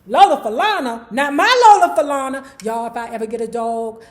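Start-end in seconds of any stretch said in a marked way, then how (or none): 0.1-2.6 loud, incredulous mock protest; playfully scandalized outburst; mock outrage. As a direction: object loudly, as if a favorite was insulted. Mock protest at article's claim.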